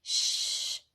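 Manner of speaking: giant shush. Acted. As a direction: blow out a big spitty shush. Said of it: "she did a giant shh".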